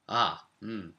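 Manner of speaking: ordinary talk, nothing marked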